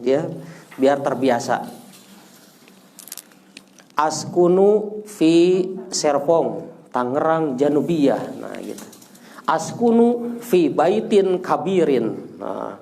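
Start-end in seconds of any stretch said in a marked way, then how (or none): 4.0-8.2 slow, deliberate "Askunu fi Serpong"; slow model sentence. Shown as a demonstration. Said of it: "latihan satu orang". 9.5-12.4 slow, deliberate "Askunu fi baitin kabirin"; slow model sentence. Shown as a demonstration. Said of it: "latihan satu orang".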